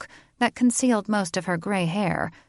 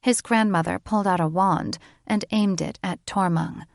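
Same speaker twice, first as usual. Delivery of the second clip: ordinary talk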